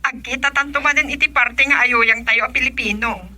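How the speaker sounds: loud, carrying voice to a neighbor; loud calling out. Acted. Neighbor chatting with her kumare, loudly calling out. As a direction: project it like calling across the yard.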